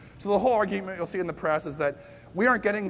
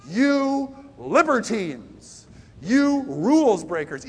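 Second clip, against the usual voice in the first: drawn-out mocking scorn, louder and higher; contemptuous scorn; 4 semitones higher, 6 dB louder, much slower than usual. Stage two scorning stage three, mock contempt. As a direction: sneer the words out slowly. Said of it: "stage two contempt towards stage three is".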